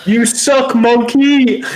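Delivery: high, loud taunt; mocking yell. Teasing friend who muted mic.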